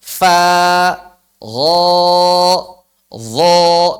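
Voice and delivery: Fa thin, gho and dho heavy and rounded; loud drill with gho and dho thick; thin and thick letters. Shown as a demonstration. Teacher drills thin and thick sounds for students. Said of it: "Beda suaranya".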